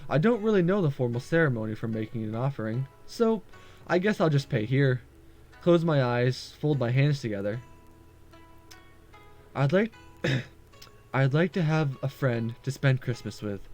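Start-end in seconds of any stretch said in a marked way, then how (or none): none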